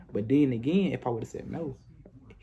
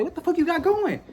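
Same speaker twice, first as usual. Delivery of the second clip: exasperated; 14 semitones higher than usual. Retold or imitated. Yelling at the girl.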